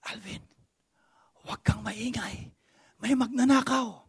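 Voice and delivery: hushed urgent whisper in Tagalog; mom's whisper. Retold or imitated. Mother warning her son about the thief, whispering. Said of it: "She was whispering".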